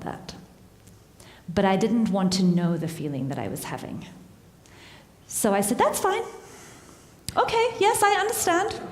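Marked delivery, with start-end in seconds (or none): 5.8-8.8 high, overly agreeable fake okay; sing-song cover-up of hurt feelings; forced fine. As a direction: pretend it's all fine, pitched way up. Fake agreeing to cancelled plans.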